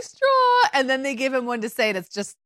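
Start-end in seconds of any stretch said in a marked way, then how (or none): 0.0-0.7 "Straw" echoed very high and louder; delighted mock echo of "Straw", pitched way up; mimicking admirers. Women parroting the British visitor's word, high mimicry. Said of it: "And they're like".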